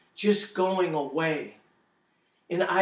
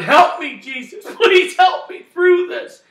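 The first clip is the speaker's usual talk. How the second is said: high, loud desperate plea, slowing on the last words; crying out to Jesus; 12 semitones higher, 11 dB louder than usual. Retold or imitated. Electrocuted man cries to Jesus, loud desperate plea. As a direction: beg Jesus for help at the top of your voice. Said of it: "kept crying out to the Lord".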